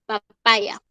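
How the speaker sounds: PAPAYA said "pa-PAI-ya"; English papaya glide. Shown as a demonstration. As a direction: PAPAYA with "eye" in the middle. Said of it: "sino papaya".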